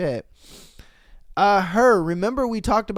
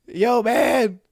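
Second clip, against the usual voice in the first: high, hammed-up sobbing plea; pretending to cry to his friends; mock crying call; 6 semitones higher than usual. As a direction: whine it to the homies. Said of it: "calling the homies like".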